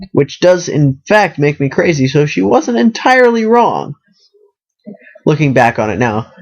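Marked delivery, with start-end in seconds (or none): none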